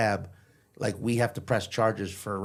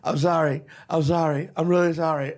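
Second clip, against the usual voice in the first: higher, pleading mock sob; imitated sobbing; 4 semitones higher, 4 dB louder than usual. Pinned drunk suddenly apologizing to the bouncer, imitated sobbing. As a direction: repeat it like a tearful drunk begging.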